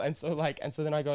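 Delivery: ordinary talk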